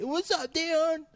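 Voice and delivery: high hammed-up fake Kiwi "What's up, Dion?"; worst fake Kiwi accent. Repeating the worst accent people greet Dion with, high and hammed-up. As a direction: ape the worst put-on accent. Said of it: "They'll put on the worst accent".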